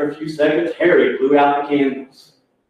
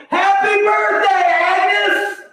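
very high, drawn-out shout; surprise party cheer; much slower than usual. Retold or imitated. Whole restaurant surprises Agnes, high drawn-out celebratory shout. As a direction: imitate a whole crowd yelling in celebration.

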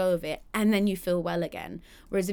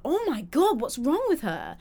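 shocked onlooker; 6 semitones higher than usual. Retold or imitated. People seeing her on a bad day, a touch of mock shock, higher. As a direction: gasp at how she looks. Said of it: "you go".

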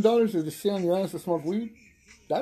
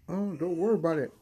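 ordinary delivery

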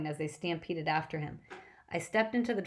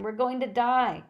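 loud, high panicked cry; panicked yelling; 6 semitones higher, 6 dB louder than usual. Acted. Dazed passengers yelling in panic outside the bus. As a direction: scream it in panic. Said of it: "running around in circles yelling".

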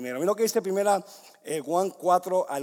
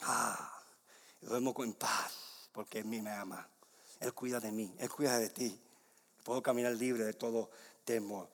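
soft, low, restful voice; low and quiet, at peace; peaceful hush; 9 semitones lower, 9 dB quieter than usual. Acted. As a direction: say it as if drifting off safely. Imagines resting in the Father's arms.

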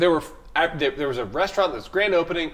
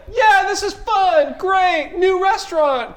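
high bright fake cheer; mock cheery high voice; 14 semitones higher, 5 dB louder, slower than usual. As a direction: chirp it like nervous friends covering. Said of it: "they're all like".

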